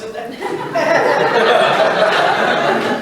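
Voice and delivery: long helpless laugh; laughing at the memory; real laugh. Real, not acted. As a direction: break into laughter at yourself. Laughing at own story.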